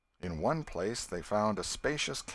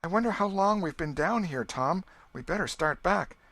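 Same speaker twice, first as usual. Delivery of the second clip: put-on higher and louder, a frightened girl's plea; apprehensive; 10 semitones higher, 5 dB louder than usual. A narrator's reading. Becky urging Tom to turn back, higher and anxious. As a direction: let worry rise into the words. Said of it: "Becky grew apprehensive".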